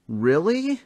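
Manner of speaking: high, loud disbelief; surprised. A narrator's reading. Thomas reacts to Rhombus's betrayal, shocked. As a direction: gasp it out in shock.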